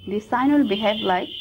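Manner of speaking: ordinary talk, nothing marked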